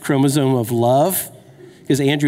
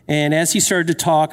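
normal talking voice

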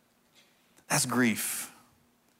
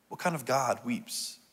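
much softer, in one quiet run; hushed repeat; 8 dB quieter than usual. Real, not acted. Preacher repeating the question to congregation, hushed. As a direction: ask it quietly, as if to ponder.

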